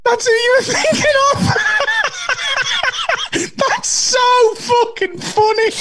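high, squeaky words through laughing; real laughing fit breaking up the words; real helpless laughter. Real, not acted. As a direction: talk while cracking up completely. Laughing at who was guessed.

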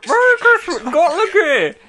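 high ventriloquist voice through a laugh; lips barely moving. Retold or imitated. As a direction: squeak it out like a ventriloquist's dummy. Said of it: "someone doing a ventriloquist voice going".

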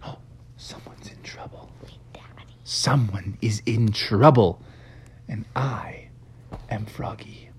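very soft, voiceless whisper; hushed, dramatic aside. Acted. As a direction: whisper it gravely to yourself. Froggy hears someone in trouble.

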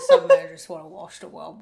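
high, loud, laughing Caine voice; Michael Caine impression. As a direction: crack up mid-impression of Caine. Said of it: "my Michael Caine impression".

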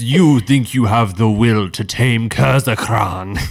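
loud, slow dragon voice in the mind; booming telepathic voice. Acted. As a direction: boom it slowly like an ancient dragon. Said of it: "a telepathic voice that booms in your head".

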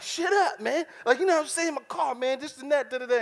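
high, loud mock rant about his car; frustrated friend ranting. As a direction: play the upset friend yelling down the phone. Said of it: "on the phone like".

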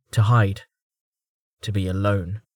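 usual speaking voice

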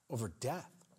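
usual speaking voice

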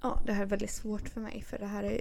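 quiet, halting mock trauma; mock shell-shocked. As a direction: confess it softly as if still in shock. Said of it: "jag blev så chockskadad".

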